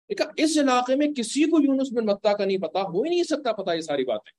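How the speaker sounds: retold astonishment, starting high; amazed realisation. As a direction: insist in wonder that it is impossible. Acts out Addas's amazed realisation. (हैरानी से भरा यक़ीन)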